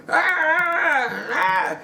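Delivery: put-on stuttering drunk. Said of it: "staggering and stuttering".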